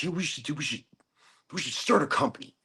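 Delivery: high-pitched excited mimicry; Andy. Imitating excitable partner proposing a company, high-pitched and excited. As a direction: blurt the idea in a high, eager voice. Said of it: "really high-pitched voice when he gets excited".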